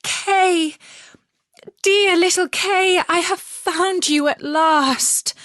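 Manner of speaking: high, loud tearful cry of joy; a girl sobbing happily to her lost friend. Acted. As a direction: weep out the words in happy relief.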